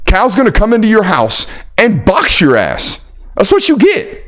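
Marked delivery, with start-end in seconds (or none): 3.4-4.2 very high mock falsetto jeer; high-pitched sing-song told-you-so; falsetto taunt. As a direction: squeak it out like a gloating cartoon.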